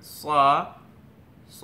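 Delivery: "স" as a full, heavy Arabic sad; heavy s sound. Shown as a demonstration. Teacher modelling for learners to try, heavy "স". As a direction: repeat "স" heavily, each one separate. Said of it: "এটা একটু ভারীভাবে".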